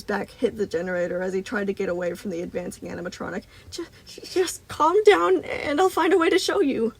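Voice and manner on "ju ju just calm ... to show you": stutter, then implore loudly; frightened pleading. A narrator's reading. Cornered boy begs Moon to calm down, stammering in high panic.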